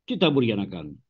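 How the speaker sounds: ordinary talk, nothing marked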